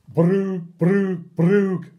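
silly noises. Acted. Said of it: "make silly sounds like".

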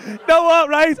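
usual speaking voice

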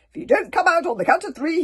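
high, loud pompous command; I-must-be-obeyed voice. Acted. Stoic warns the cauldron in his most important voice. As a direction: boom it out to be obeyed. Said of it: "his most important "I must be obeyed" voice".

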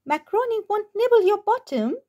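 a playful dog poking fun. Acted. Dog voiced in story.

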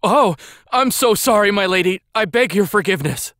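high, frantic begging for forgiveness; cowering apology. A narrator's reading. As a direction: apologize in terror to your mistress. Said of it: "the boy stuttered and stammered a thousand apologies".

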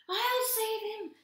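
very high, loud squeak; squeaky. A narrator's reading. Squirrel squeaking he will save the duck. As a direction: squeak it like a brave little squirrel. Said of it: "squeaked the squirrel".

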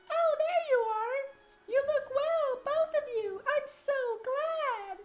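high, gracious falsetto queen; a gentle queen, slowly; queen mother voice. Acted. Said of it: "like her mom".

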